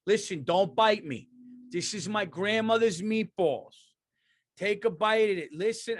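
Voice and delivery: high, urgent Italian coaxing; Italian mob guy. Acted. Italian offering a zombie his grandmother's meatballs, urgent coaxing. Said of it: "if an Italian was across the zombie".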